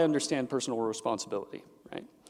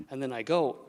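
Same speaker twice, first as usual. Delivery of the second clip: usual speaking voice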